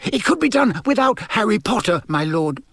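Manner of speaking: high voice, forcing it out; nervous blurt. A narrator's reading. Servant forcing out a suggestion to his lord, nervous. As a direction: blurt it before your nerve goes. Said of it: "the words tumbling from him in a rush".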